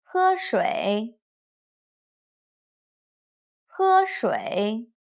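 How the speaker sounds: slow drill of "喝水", level then low-rising; first plus third tone. Shown as a demonstration. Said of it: "first tone plus third tone".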